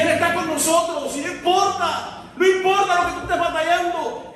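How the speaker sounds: loud, high fervent preaching. Acted. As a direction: proclaim it with fire. (predicando con fuerza)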